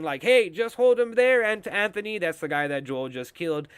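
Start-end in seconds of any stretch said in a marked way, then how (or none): 0.2-2.2 higher, louder bossy order; Kathleen on the radio. Retold or imitated. Kathleen ordering her man over the radio, higher and louder. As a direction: bark it like a ruthless leader. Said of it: "he hears Kathleen saying".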